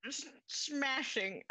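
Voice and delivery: slow stuttered smashing; a hint of a nervous inventor; stammering smashing.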